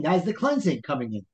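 ordinary talk

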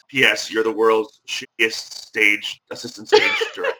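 reading a friend's cheeky note and cracking up on the last words; laughter breaking into the line; laughing through the quote. Retold or imitated. Podcast host reads a friend's teasing note, laughing. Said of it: "made me laugh".

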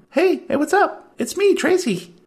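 high chirpy desk clerk; Tracy voice. Acted. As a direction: pitch way up for the clerk. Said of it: "you see Tracy behind the counter".

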